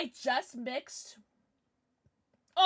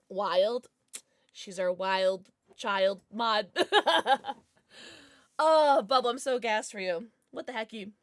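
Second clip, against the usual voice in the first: ordinary talk, nothing marked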